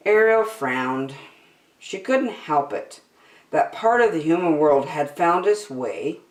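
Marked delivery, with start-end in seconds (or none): none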